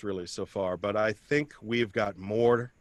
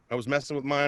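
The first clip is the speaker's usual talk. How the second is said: ordinary talk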